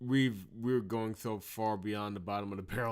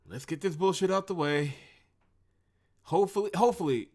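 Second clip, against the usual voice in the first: exasperated plea; 8 semitones higher, 6 dB louder than usual. Real, not acted.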